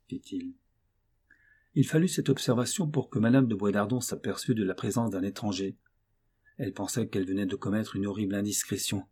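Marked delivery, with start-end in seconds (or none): none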